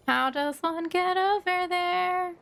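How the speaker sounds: usual speaking voice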